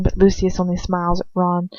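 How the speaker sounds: usual speaking voice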